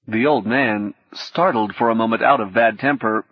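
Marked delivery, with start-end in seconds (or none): none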